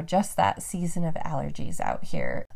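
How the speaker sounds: ordinary talk, nothing marked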